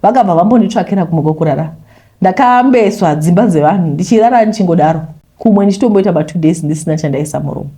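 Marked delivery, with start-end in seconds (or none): none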